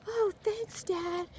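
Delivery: put-on very high, sweet child voice; mock kid voice. Son thanking his dad for the ball, in a very high kid voice. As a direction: squeak it like a thrilled little boy. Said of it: "And he's like".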